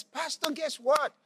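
high-pitched breathless-with-news excitement; eager, high-pitched retold excitement; excited. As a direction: burst in bubbling over with good news. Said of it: "she's so excited. She says".

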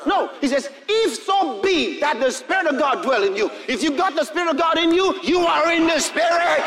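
high, loud, fervent sermon shouting; full-force preaching. Acted. Preacher rousing the congregation, full force. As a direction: preach it at the top of your voice.

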